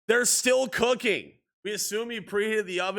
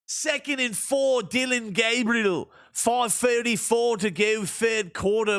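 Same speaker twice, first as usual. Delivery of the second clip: sports commentator cadence; play-by-play call; 3 semitones higher, slower than usual. Acted. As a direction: call the game like a broadcaster. Video game play-by-play.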